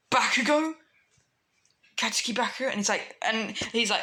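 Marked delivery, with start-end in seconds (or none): 0.1-2.7 higher, rising, disbelieving question; shocked disbelief. Acted. Deku hearing his friend is captured, shocked. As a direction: say the name as a startled question.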